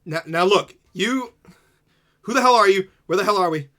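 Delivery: high, loud demanding yell; angry shouting. Acted. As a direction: confront the strangers angrily. NPC confronts the intruders.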